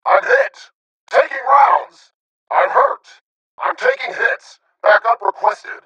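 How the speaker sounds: strained battle cry under fire; urgent, hurt combat bark; wounded soldier callout. Acted. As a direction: call out that you've been hit. Soldier acting being hit in battle.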